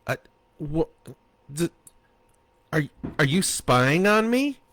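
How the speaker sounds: flustered stammer, rising high at the end. A narrator's reading. Husband caught out, stammering at his wife. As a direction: stumble over the words, caught out. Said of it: "He wrestled with words".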